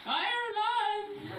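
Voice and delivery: "Ireland!" high and stretched out, with a proud wrong tone. Retold or imitated. Husband answering in Chinese, a high, slow, mistoned "Ireland!". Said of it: "he's like".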